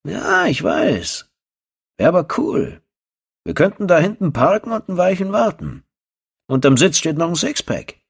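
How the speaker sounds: a dumb lout excited by a gory show; dim eager thug. Acted. Second thug suggesting they wait and watch, keen and high.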